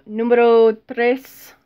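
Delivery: slow, drawn-out mock Spanish numero tres; overdone Spanish accent on "numero tres"; bad Spanish accent. Shown as a demonstration. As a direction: roll out "numero tres" like a hammy Spanish speaker. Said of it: "that's terrible accent".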